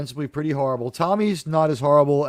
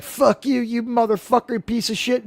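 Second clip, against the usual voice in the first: higher-pitched mock rant; hammed-up tirade, pitched up; crazy ranting; 6 semitones higher than usual. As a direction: imitate someone flying into a cursing rant. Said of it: "starts in her crazy ranting".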